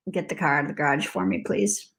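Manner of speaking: soft, low, quick ask; resigned request. Retold or imitated. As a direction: ask quietly and fast, giving up. Retells resigned request to husband.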